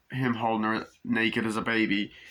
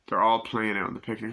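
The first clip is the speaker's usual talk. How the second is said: ordinary talk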